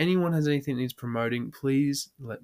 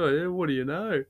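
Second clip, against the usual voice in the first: imitated mate's catchphrase; 5 semitones higher than usual. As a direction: put on the cheeky mate, singsong. Mimics mate turning slowly to cop.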